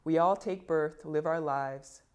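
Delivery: ordinary delivery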